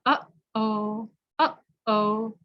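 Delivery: "Uh-oh" with a catch in the throat between the vowels; "Uh-oh" cut by a throat stop; glottal uh-oh. Shown as a demonstration. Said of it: "feel in your throat".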